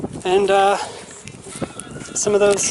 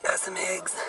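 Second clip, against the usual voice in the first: a soft unvoiced whisper; 9 dB quieter than usual. Real, not acted. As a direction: whisper it to yourself. Whispered aside.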